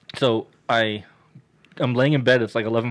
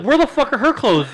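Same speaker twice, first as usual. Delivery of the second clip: high, louder retold outburst; exasperated demand; 11 semitones higher, 6 dB louder than usual. Demanding clothes from Keith in retold row.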